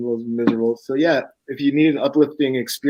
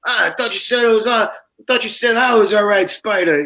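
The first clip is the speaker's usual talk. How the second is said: higher, slurred-sounding sheepish excuse; sheepish drunk excuse; 8 semitones higher, 4 dB louder than usual. Retold or imitated. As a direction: bluff your way out sheepishly.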